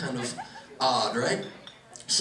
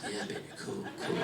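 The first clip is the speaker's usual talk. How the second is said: stoned dad; 10 semitones lower, 13 dB quieter than usual. Retold or imitated. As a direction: mumble it, far gone. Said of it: "He goes".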